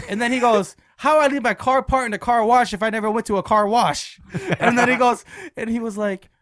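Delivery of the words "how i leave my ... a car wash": louder, higher indignant question; offended. Retold or imitated. Coworker denying any car wash visit, offended. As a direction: imitate an exasperated man protesting. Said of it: "he's getting offended".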